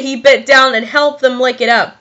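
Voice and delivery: ordinary delivery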